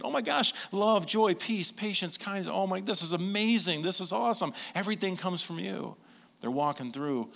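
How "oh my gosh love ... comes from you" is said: high, loud, thrilled gushing; gushing like a kid opening gifts. Retold or imitated. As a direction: unwrap each gift with wide-eyed glee. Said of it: "We just wanna tear 'em open".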